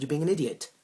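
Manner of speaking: loud, high-pitched insult; really angry. Acted. Man repeating his insult to his date, angry. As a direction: drive it home in exasperation. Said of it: "I was getting really angry".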